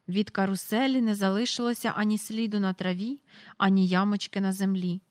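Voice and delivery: ordinary delivery, nothing marked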